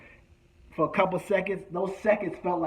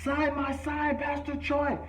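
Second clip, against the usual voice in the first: high, pleading fan voice; eager fan begging; 8 semitones higher, 4 dB quieter than usual. Retold or imitated. Friend Rich begging the rapper to sign his poster, high and pleading. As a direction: beg for the autograph up high. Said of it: "and says".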